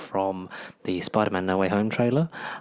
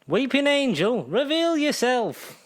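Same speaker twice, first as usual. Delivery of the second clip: high, slow theatrical call; slow, high mock-grand invocation; dramatic summons; 12 semitones higher, 3 dB louder, slower than usual. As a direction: summon the angel in a high, drawn-out voice.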